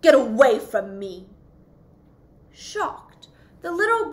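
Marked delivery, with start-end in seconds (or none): 0.0-1.3 loud, scornful outburst; proud fish loudly rejecting a request; indignant cry. Acted. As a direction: snap at the little fish, offended. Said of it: "cried the Rainbow Fish".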